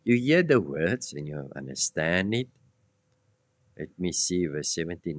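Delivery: ordinary talk, nothing marked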